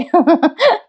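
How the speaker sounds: short high laugh; high teasing chuckle; amused laugh. Real, not acted. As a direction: laugh at the poor husband's lot. Amused aside to viewers.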